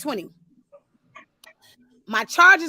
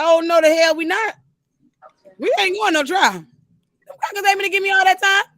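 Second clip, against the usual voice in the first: louder, higher mock outrage at the lawyer; indignant refusal; 4 semitones higher, 4 dB louder than usual. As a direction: replay the refusal with heat, a bit higher. Refusing lawyer's trial plan, retold.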